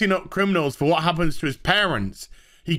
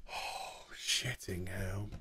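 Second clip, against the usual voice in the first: whispered, much softer grumble; muttered curse; 11 semitones lower, 19 dB quieter, much slower than usual. Real, not acted. Reviewer muttering a curse to himself, very soft. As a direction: curse under the breath.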